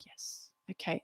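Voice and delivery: barely voiced, very soft reply; acted timid little yes, almost no voice; meek acknowledgement. Junior doctor passively taking a job from the consultant, meek.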